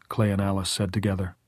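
plain reading voice

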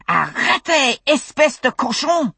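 French shout. Acted. Fergus yelling in French at the stranger, furious high shout. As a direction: bark it in French at the intruder.

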